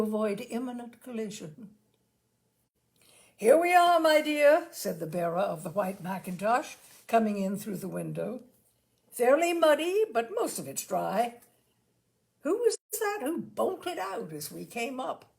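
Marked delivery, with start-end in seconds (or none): 3.3-4.7 much higher, louder hearty greeting; cheerful hunter calling to his wife. A narrator's reading. Husband returns from shooting. 9.1-15.3 jovial, loud then puzzled husband; a hint of a bluff country gentleman; hearty hunter. A narrator's reading. Husband returns from shooting.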